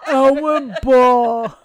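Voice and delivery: slow, loud, laughing through a sticky mouthful; mouth full of peanut butter. Pretend. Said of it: "my mouth full of peanut butter".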